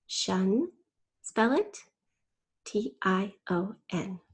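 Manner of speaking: ordinary talk, nothing marked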